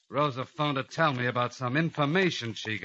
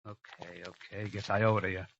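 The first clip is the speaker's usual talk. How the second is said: much softer, giving in; defeated, barely audible agreement; resigned; 5 semitones lower, 7 dB quieter than usual. Acted. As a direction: mutter your surrender. Gives in to Rosa.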